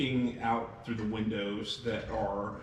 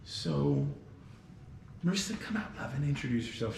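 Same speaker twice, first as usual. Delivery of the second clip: lightly raised coaxing hostess voice; coaxing innkeeper; 4 semitones higher than usual. Acted. Lydia coaxes the girl to meet the guests, lightly high. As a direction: coax the shy child out gently. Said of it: "And Lydia goes".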